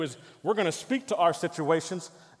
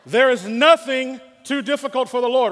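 much louder, higher proclamation; loud preaching; 7 semitones higher, 10 dB louder than usual. Acted. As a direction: push it out to rouse the church. Crowd called weak, says louder.

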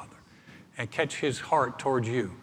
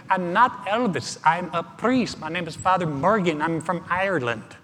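bad Irish accent; 5 semitones higher than usual. Priest telling the taxi driver he's not [name], bad Irish accent. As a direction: protest in a badly faked Irish accent. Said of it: "that's a horrible Irish accent".

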